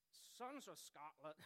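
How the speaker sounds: halting bad Scottish accent, closer to Northern Irish; botched Scottish accent. Speaker attempting a film hero's call to his army, bad Scottish accent. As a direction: try Scottish and stumble into it. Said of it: "I can't do a Scottish accent".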